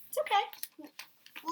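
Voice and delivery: calm him down tenderly; soothing reassurance. Real, not acted. Teacher soothing the child.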